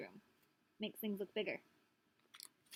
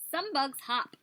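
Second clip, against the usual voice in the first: put-on reading to children; 3 semitones higher, 5 dB louder, slower than usual. Reading book aloud.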